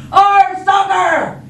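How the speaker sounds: high, loud, drawn-out shout; whole crew yelling. Acted. Crew echoes Braidbeard at soccer, full shout. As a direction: yell it all out as a crew. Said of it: "everybody else yelled".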